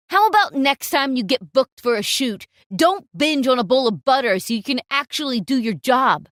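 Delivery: loud, mocking jab at the model; sneering insult. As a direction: sneer it down at her.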